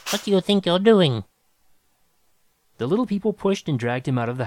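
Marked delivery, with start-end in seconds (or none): 0.1-1.2 loud, high, outraged screech. A narrator's reading. Musician confronting piano wreckers, screeching. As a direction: screech in protest at the vandals. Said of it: "Groverbs screeched".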